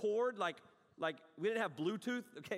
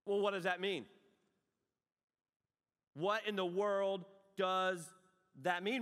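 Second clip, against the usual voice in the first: ordinary delivery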